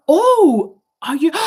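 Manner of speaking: high, loud retold gasp of surprise; surprised exclaim. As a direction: replay the moment it clicked, startled. Retold surprise at meeting friend's mom.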